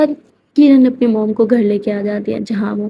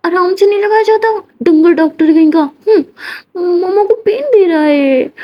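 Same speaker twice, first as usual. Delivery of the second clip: put-on childish huff; 7 semitones higher than usual. Kiran scolds the doctor from outside.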